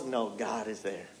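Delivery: hushed and low, as the spirit speaking comfort; soft inner reassurance. Acted. Preacher voicing the spirit's calm answer, hushed and tender. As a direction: breathe it gently like a quiet inner voice.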